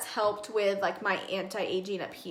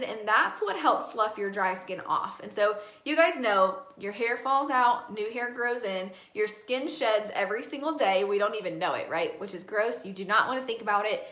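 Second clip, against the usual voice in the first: ordinary talk, nothing marked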